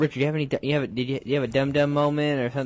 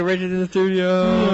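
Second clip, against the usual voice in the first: higher, louder host hype; excited announcement; 9 semitones higher, 6 dB louder than usual. Acted. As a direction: announce the guest like a showman.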